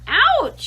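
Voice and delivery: a pig jolted awake by a bite; pained squeal. A narrator's reading. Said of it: "he screamed".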